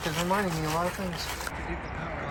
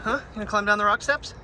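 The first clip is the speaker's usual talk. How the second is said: coaxing pet talk; 5 semitones higher than usual. Real, not acted. Coaxing dog down the rocks.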